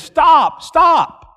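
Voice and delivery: high, loud shouted command. Retold or imitated. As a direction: shout to halt someone in their tracks. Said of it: "he yells louder at me".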